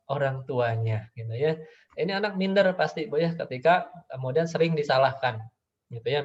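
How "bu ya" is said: ordinary talk, nothing marked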